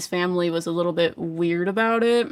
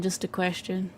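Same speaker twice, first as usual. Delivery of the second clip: "just a question" dropped to a much softer murmur; hushed repeat; 9 dB quieter than usual. Real, not acted. Reader repeats her question to Mari as a much softer aside. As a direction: echo the phrase under your breath, much softer. Repeating the asking phrase more softly.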